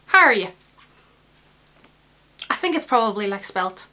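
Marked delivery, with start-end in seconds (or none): 0.1-0.5 "How are you?" slurred to "hah-ree-ya", with no W and the Y merged; Irish "how are you". Shown as a demonstration. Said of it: "You don't even pronounce the W and the Y".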